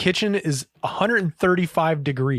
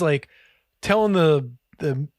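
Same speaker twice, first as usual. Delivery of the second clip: ordinary talk, nothing marked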